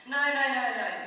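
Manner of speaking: loud, high, insistent string of noes; emphatic denial. A narrator's reading. Dom denying he is rich, emphatic. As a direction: cut him off with urgent denial. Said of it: "shook his head emphatically".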